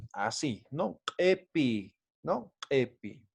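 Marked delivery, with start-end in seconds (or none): 1.2-2.0 Epi starting on an E; first I lowered to E. Shown as a demonstration. Teacher repeating the model for students, Epi with E. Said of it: "sino voy a decir epi". 2.7-3.2 Epi with an opening E, softer; first I lowered to E. Shown as a demonstration. Teacher repeating for students, softer Epi with E. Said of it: "sino voy a decir epi".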